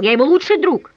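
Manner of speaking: almost shouting down the phone; near shout. Acted. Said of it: "Я уже почти кричал".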